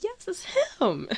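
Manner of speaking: high-pitched fangirl squeal; excited confirmation. Retold or imitated. Fan confirming to her friend it's him, excited squeal.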